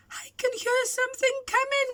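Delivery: high, fast frightened whine; whining fear. Acted. Scared chimpanzee whines to the hiding animals. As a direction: whine it nervously. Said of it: "whined the chimpanzee".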